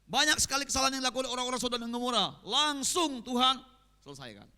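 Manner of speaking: fiery preaching. Acted. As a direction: preach it hard at the sinners.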